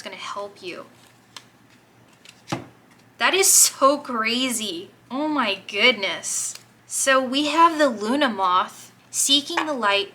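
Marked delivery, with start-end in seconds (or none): 3.2-10.1 real excitement. Real, not acted. Card reveal, overjoyed reaction.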